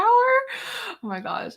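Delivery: high, slow amused oh my gosh; amused, drawn-out. Real, not acted. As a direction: stretch it out in delight.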